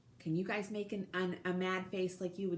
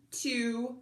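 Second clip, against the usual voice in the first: ordinary delivery, nothing marked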